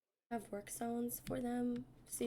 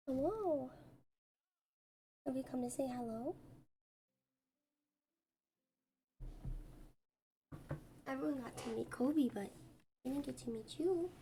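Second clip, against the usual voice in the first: talking to a pet; 2 semitones higher than usual. Real, not acted. Cooing at her cat.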